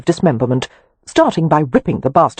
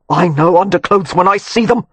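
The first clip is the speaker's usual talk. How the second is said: snapped retort; 3 semitones higher than usual. A narrator's reading. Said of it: "he snapped".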